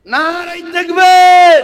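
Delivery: long high shout of নারায়ে তাকবীর; rallying shout. Real, not acted. Preacher rousing the crowd with a loud call.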